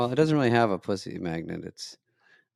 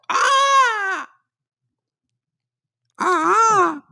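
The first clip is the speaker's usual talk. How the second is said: high piercing prehistoric screech; pterodactyl shriek; 4 dB louder than usual. Shown as a demonstration. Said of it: "I can do a pterodactyl".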